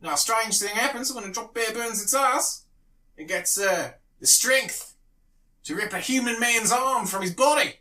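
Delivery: exaggerated Aussie storyteller, pitched high; Australian accent. Comic building to the drop bear punchline, Australian accent. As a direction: spin a tall tale in a hammed-up Australian voice. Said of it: "pretend to be an Australian man".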